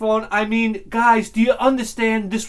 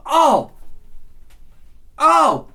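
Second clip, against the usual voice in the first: excited oh; 8 semitones higher, 4 dB louder than usual. Real, not acted. Reacting to drum triplets.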